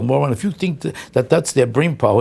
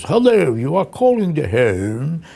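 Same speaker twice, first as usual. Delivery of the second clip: fake phone voice; 2 semitones higher, slower than usual. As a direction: sound like a totally different person. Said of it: "you could never recognize their voices".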